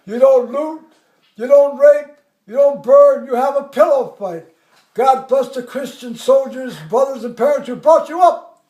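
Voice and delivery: loud, emphatic list, hammed-up oratory; colonel's speech. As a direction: hammer each phrase like a commander addressing troops. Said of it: "the colonel's there".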